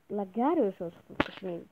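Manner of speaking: normal talking voice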